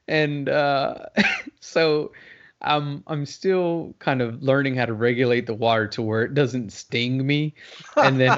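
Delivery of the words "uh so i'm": usual speaking voice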